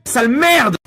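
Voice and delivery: loud, high outburst; angry insult. Real, not acted. Streamer cursing at someone in game, angry shout. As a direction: explode with a short angry yell.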